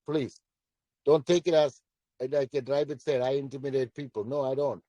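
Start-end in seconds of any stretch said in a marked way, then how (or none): none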